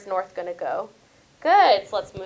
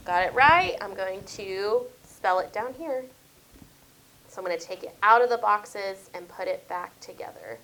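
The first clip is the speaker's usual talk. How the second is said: usual speaking voice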